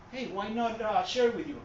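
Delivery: ordinary delivery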